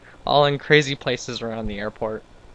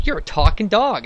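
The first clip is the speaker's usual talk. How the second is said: higher, louder mock disbelief; a touch of amazement, pitched up; mild astonishment; 7 semitones higher, 5 dB louder than usual. Man to the talking dog, mildly astonished.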